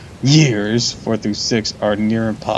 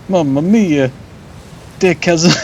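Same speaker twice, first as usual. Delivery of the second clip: hammed-up higher Italian accent, "Mamma mia" sung out with "mia" falling; 7 semitones higher than usual. Streamer lampoons the Italian port-town kid, hammed-up Italian accent. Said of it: "Surprised he didn't say".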